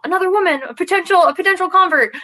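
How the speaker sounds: high-pitched, excited imitation of eager recruiters. Women spotting a potential convert at the gym, excited. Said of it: "they were so excited".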